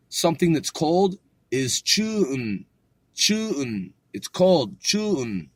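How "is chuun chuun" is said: ordinary delivery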